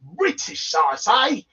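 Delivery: mock British accent; playful fake British accent. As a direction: put on a hammy British voice. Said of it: "So this movie is British".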